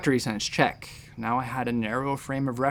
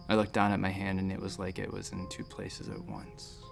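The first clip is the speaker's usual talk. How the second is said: soft, lower, trailing into near silence; hushed recollection; 4 semitones lower, 7 dB quieter than usual. Acted. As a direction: recall it softly, as if entranced.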